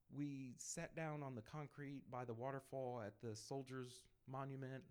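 ordinary talk

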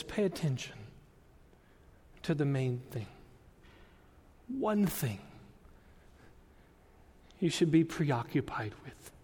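very soft, measured, with long pauses; hushed appeal. Acted. Preacher voices Advent's call to the congregation, hushed. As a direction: press it home quietly, almost under your breath.

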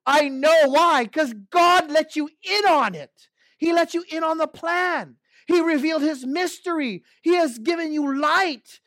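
high, loud, urgent preaching; fervent pulpit preaching; preaching at full force. Real, not acted. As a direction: build the sermon to a fervent peak.

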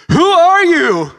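high, loud, drawn-out startled cry of the neighbor. Retold or imitated. Neighbor's startled shout retold.

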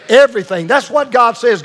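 a loud, punched single word for the congregation to echo; loud and emphatic. Shown as a demonstration. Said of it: "Say it real loud. Say it emphatically".

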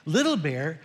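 normal talking voice

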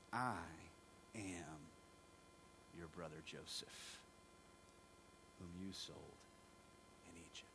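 soft, low, halting reveal; tearful reveal. Retold or imitated. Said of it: "he's weeping, he's crying".

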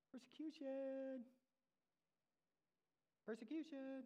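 high, drawn-out mock call; playful singsong. Preacher jokingly announces what to expect, playful singsong. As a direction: sing it out lightly, pitched well up.

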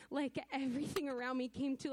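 ordinary delivery

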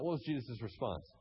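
normal talking voice

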